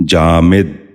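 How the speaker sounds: جامد said "jaa-mid", with short i after the m; short i, not a, in the last syllable of جامد; correct pronunciation. Shown as a demonstration. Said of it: "آپ بھی ذہن نشین کر لیجئے".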